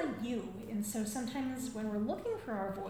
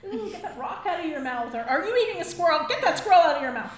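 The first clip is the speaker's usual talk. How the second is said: scolding grandmas; 2 semitones higher, 9 dB louder than usual. Retold or imitated. Grandmas watching babies eat everything, mock scolding. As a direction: start mild, build to alarmed mock scolding. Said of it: "the grandmas would be like".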